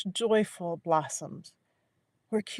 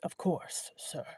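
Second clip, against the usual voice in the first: rusty voice; 8 dB quieter, slower than usual. A narrator's reading. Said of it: "Capio's rusty voice".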